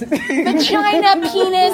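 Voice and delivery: high, loud, slow parody; mock denial. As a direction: draw it out in a high mocking voice. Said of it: "oppression through denial".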